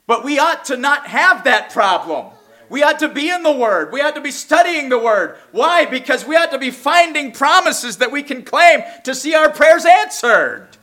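high, loud, rising sermon voice; full-force pulpit exhortation; fiery preaching. Real, not acted. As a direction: preach it out, building to a climax.

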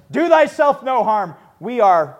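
loud, high cry to stop him; urgent call. Retold or imitated. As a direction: shout to stop the jailer's sword. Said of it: "he says".

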